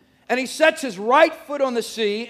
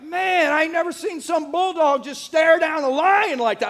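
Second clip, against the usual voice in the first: higher-pitched, acted amazement; amazed onlooker; 5 semitones higher than usual. Crowd watching a bulldog stare down a lion, acted amazement. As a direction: marvel like a stunned onlooker. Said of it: "everybody was like".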